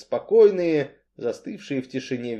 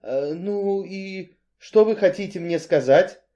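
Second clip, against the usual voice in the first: a touch of dread, pitched higher; trembling apprehension; 6 semitones higher than usual. A narrator's reading. Cain asks what the strongman wants, with trepidation. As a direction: ask anxiously, a little higher. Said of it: "с трепетом спросил Каин".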